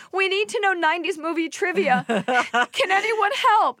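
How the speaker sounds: high-pitched hammed-up cry for help; mock panic. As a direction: plead frantically, stretching the final plea high. Acting out a frantic plea for help.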